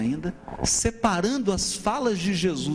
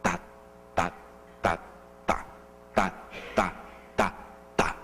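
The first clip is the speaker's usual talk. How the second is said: sílabas soltas marcando o tempo; batendo o ritmo; 11 semitones lower, 6 dB quieter than usual. Shown as a demonstration. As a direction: bate o ritmo com tá. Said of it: "tem fala de Jesus que tá nesse ritmo". (marcando o ritmo com sílabas)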